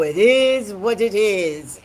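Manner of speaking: put-on a lightly done African accent, loud and slow; African accent impression. Imitating African boys on Pop the Balloon, African accent. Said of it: "and they go".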